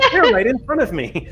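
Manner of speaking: chuckling through a self-mocking quote; laughing. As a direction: laugh at your own mix-up as you say it. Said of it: "and I'm just like".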